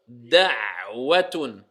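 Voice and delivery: da'watun with a deep throaty ayn, slow; clear ayn. Shown as a demonstration. Tajwid teacher modelling the word for students. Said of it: "Vous avez vu, da'watun".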